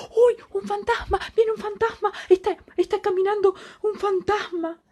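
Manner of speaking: high, panicked cry of ghost; frightened cry. Acted. Disciples see a figure on the water, high frightened cry. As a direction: cry out high in terror, a storyteller's mock panic. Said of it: "Se imaginaron que era un fantasma y gritaron".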